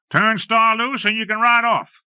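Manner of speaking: high, loud shouted offer; calling out. A narrator's reading. Gunman calling his terms to the ranger, loud and high. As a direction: yell the deal from cover. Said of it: "a voice called".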